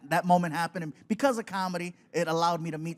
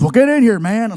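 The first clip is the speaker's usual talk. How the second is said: loud barked order in a raised voice; Stone Cold voice; 4 semitones higher, 15 dB louder than usual. Retold or imitated. Wrestler hollering at the comedian to come inside, loud. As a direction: boom it like a wrestler waving you in.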